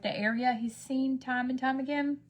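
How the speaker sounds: normal talking voice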